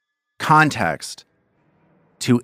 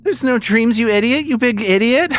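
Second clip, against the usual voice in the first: put-on creepy girl voice. Possessed girl mocks the party, high scornful voice. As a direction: sneer at them like a bratty possessed child.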